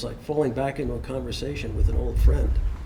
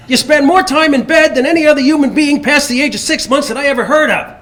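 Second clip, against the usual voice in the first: high, loud ranting Nicholson voice; Jack Nicholson impression; 12 semitones higher, 10 dB louder than usual. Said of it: "[name] really had Nicholson down".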